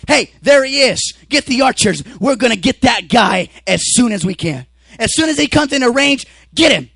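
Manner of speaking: loud, urgent shouted orders; watchmen calling out. Acted. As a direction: holler it down the wall to the archers.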